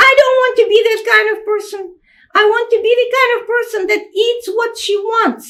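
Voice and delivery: put-on a defiant small child; little girl's voice. Little sister protesting she won't be a person, high child voice. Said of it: "make it sound like a children's voice".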